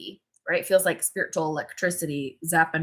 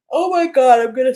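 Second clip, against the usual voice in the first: spoken through a yawn; 6 semitones higher, 9 dB louder than usual. Real, not acted. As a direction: let a yawn break the words. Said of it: "channeling all the yawns".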